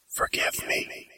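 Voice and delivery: breathy unvoiced whisper. A narrator's reading. Whispering a farewell to him. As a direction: breathe it out, barely there.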